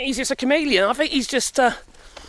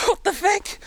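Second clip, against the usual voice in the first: high, laughing "What the feck?"; laughing disbelief; 7 semitones higher than usual. Real, not acted.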